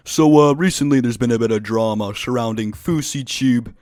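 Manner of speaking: loud opening, lightly put-on YouTuber voice; loud, lightly imitated video intro; Pyrocynical impression. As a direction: announce the drama like a commentary YouTuber. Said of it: "That's my best, uh, Pyrocynical impression".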